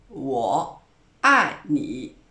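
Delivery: 我爱你 said very slowly, dipping 我, falling 爱, dipping 你; slow model pronunciation. Shown as a demonstration. Teacher teaching the key Valentine phrase, slow and careful. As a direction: sound out 我爱你 slowly, syllable by syllable. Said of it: "我, c'est troisième ton".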